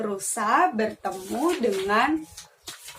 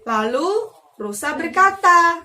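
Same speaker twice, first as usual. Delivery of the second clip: plain reading voice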